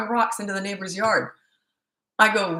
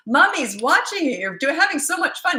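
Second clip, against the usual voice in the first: put-on sing-song to toddler; 9 semitones higher, 3 dB louder than usual. Mom calling to son from the window, high and sing-song. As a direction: coo encouragement to a little boy.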